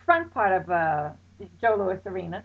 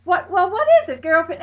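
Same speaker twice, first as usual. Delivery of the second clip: anxious urgent question; 6 semitones higher, 7 dB louder than usual. Retold or imitated. Narrator asking friend anxiously.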